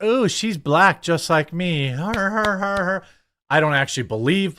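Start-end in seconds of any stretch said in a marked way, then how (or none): 0.0-3.0 higher, slow, gushing mock voice; mock gushing fan. Podcaster mocks journalists fawning over a candidate, gushing mock voice. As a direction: gush like a starstruck fan. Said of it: "they're like".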